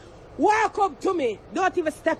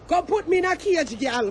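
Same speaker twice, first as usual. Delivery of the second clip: usual speaking voice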